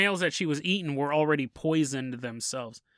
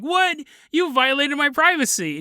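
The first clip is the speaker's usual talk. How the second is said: high, louder fake outrage; mock indignation; 8 semitones higher, 5 dB louder than usual. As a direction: play the wounded innocent.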